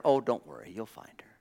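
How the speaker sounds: low, soft reply that fades to a near whisper; acted calm reassurance, trailing very quiet; reassuring. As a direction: gently soothe the worrier, voicing the master. Abraham soothing the worried servant.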